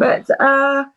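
fighting not to cry as she speaks; real emotion breaking through; holding back tears. Real, not acted. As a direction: voice catching on the edge of tears. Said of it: "sorry, I'm gonna start crying already".

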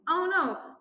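high, loud dismay; hammed-up reaction to danger; mock alarm. A narrator's reading. Team hearing a boom, mock alarm.

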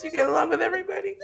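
can't believe he said it; laughing disbelief. Real, not acted. Podcaster mocks Eric's claim, laughing incredulous. Mocking the boyfriend's boast.

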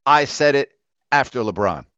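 ordinary delivery

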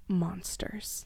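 a spooky whispered title. Acted. Host announcing the story title in a whisper.

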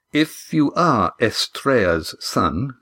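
slow, deliberate weight on Estrea's; careful emphasis. A narrator's reading. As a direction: pick out "Estrea's" slowly and carefully. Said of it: "carefully emphasizing the different pronunciation".

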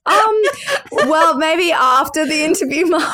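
high, laughing, awkward brush-off; her own younger self. Retold or imitated. Host replaying fobbing off her mum, high and giggly. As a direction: put off mum gently through a laugh. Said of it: "And I was like".

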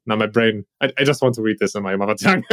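ordinary talk, nothing marked

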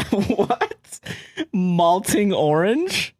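high-pitched laughing "what?", then slow questioning; incredulous, high and drawn-out; baffled disbelief. Real, not acted. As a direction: stretch it out in amused bafflement. Reacts baffled to the garbled line.

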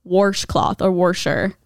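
"Washcloth" and "Warsher" with an r before the sh; warsh pronunciation. Retold or imitated. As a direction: slowly sound the extra r in "Washcloth" and "Warsher". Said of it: "wonder where that came from".

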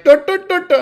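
mocking high, loud nonsense syllables of a bad Pakistani accent; terrible Pakistani accent. Critic mimicking people who do terrible Pakistani accents, mocking. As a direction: caricature a botched Pakistani accent as a string of noises. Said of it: "It just sounds like".

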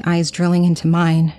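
usual speaking voice

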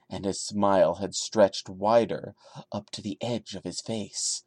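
low, hushed creepypasta reading; story reading. Acted. Reading the Sonic creepypasta aloud to viewers, hushed and low. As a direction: read the creepy tale in a low, even hush.

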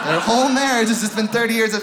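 normal talking voice

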